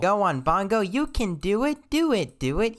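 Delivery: high, loud cheering voice; cheerleader. Acted. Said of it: "Is this guy acting like a cheerleader".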